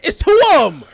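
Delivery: high, very loud yell of alarm. Real, not acted. Player spotting two enemies, alarmed yell. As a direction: shout it out in sudden panic.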